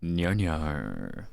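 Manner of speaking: edgelord voice. Shown as a demonstration. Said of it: "I can do the edgelord voice a little bit".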